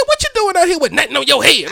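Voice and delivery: a hint of a worried mom scolding; fussing mama. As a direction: nag like a mom, mildly. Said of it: "She said".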